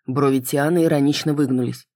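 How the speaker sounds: plain reading voice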